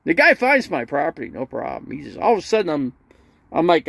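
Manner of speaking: usual speaking voice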